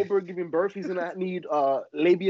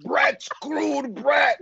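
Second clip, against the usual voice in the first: wrestling promo; 7 semitones higher, much slower than usual. Retold or imitated. Wrestling promo bit, Vince impression.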